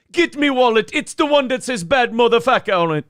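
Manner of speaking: high-pitched Jamaican patter; Jamaican accent. Acted. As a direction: put on a comic Jamaican accent. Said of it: "I fucked up the accent".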